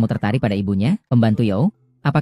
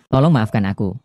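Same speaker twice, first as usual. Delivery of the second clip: ordinary talk, nothing marked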